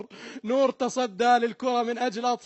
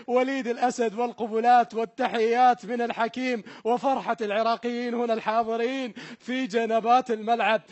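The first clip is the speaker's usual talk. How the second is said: ordinary talk, nothing marked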